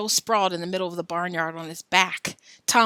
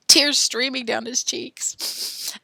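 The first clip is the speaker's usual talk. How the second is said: mock tearful; 5 semitones higher, 4 dB louder, much slower than usual. Shown as a demonstration. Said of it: "Where are my tears?".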